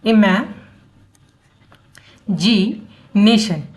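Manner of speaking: "Imagination" drawn out in slow, separate chunks; broken into syllables. Shown as a demonstration. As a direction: teach "imagination" piece by piece, leaving long pauses between the syllables. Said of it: "तीन टुकड़ों में पढ़ के". ("Imagination" को रुक-रुक कर, syllable तोड़कर पढ़ें)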